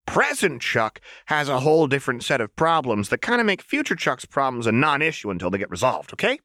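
put-on high, sing-song playful girl; high chirpy menace; manic Jinx voice. A narrator's reading. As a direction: tease the hostage brightly.

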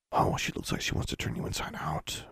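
soft whispered aside. Acted. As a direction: whisper a warning to a sibling. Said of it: "Magnificent whispered to Awesome".

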